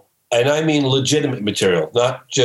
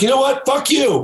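much higher, yelled comeback; shouted retort; 10 semitones higher than usual. Retold or imitated. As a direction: top her insult with a full yell. Larry cursing back, topping her.

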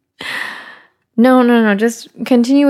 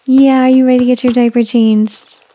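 higher, louder sing-song question; 3 semitones higher, 5 dB louder than usual. Real, not acted. Asking baby again.

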